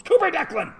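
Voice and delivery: high, loud mock scolding of the dog; shouting a pet's name; yelling at the dog. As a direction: call the dog's full name like he's in trouble. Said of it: "we yell at him with his middle name".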